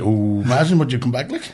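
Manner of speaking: ordinary delivery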